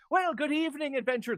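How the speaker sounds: loud, high Irish lilt; big hearty Irish-accented welcome. Acted. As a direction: greet them as a hammed-up Irish mayor. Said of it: "There goes the Irish accent again".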